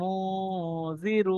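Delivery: আতামুজি drawn out slowly and softly, nasal ghunnah held; soft slow Quranic reading; nasalized reading. Shown as a demonstration. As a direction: hum the n through the nose, slow. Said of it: "তাই পড়বো".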